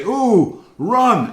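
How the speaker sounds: high, loud mock cry of alarm; hammed-up, swooping warning cry; acted alarm. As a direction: play-act spotting a storm and urging escape. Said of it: "you're looking up and you say".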